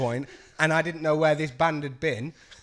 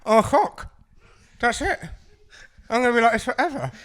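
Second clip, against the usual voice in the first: mock panic; 7 semitones higher, 5 dB louder than usual. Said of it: "You're like".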